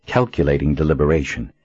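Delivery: ordinary delivery, nothing marked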